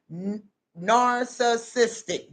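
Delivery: "narcissistic" slowly, syllable by syllable; sounded-out word. Shown as a demonstration. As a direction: carefully draw out each part of "narcissistic". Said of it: "That's how they say narcissistic".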